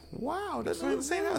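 put-on high "wow" voice; mock amazement. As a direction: imitate astonished homeowners. Mimics amazed house owners.

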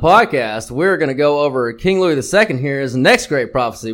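usual speaking voice

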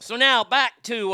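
ordinary talk

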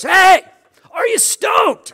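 high, booming drawn-out shout; exuberant pastor greeting. Retold or imitated. Pastor grabbing you by the shoulders, exuberant shout. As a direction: holler it like a hyped-up, big-hearted pastor. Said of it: "And he goes".

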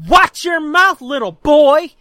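loud, high angry warning; imitating an offended girl telling off a heckler; scolding girl. Big sister to a heckling boy, loud scolding. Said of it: "she turns and she goes".

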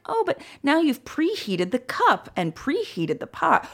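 high, slow gentle correction; sweet old lady. Retold or imitated. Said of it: "she was so cute and so sweet".

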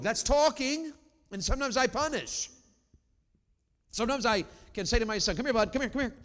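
high, loud preacher's cry; ringing, emphatic sermon delivery; full-force preaching. Real, not acted. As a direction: preach it out to the whole room.

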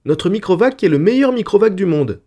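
put-on high, loud child's chirp; chirping little girl. A narrator's reading. Little daughter boasting of the family Microvac, chirping. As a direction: pipe it up like an excited little daughter. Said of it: "pépia Gérodette un".

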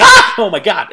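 jolted awake, shriek then gasp it out; startled scream. Retold or imitated. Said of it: "she's like".

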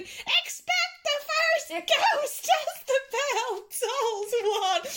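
very high, slow ghostly wail; ghostly Muppets voice. Retold or imitated. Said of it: "reenacting that line from the Muppets Christmas Carol".

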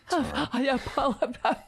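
laughing through a mock sob; mock the crying nominee's apology. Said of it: "This is, he's crying".

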